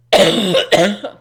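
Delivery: loud hacking cough; real coughing fit. Real, not acted. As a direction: cough hard, choking on it. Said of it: "on my own cough".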